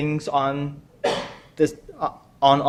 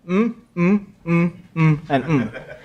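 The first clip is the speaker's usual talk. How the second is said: short "mm" for each word; hummed placeholders; slower than usual. Shown as a demonstration. Quiz host hiding the answers from players, hummed placeholders. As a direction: hum in place of each name. Hums in place of unpronounced words.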